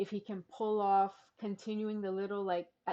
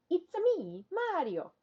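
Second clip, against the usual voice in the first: high, bouncy Italian plumber voice; cartoonish Italian accent, pitched way up; Mario impression; 10 semitones higher than usual. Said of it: "I can do some impressions".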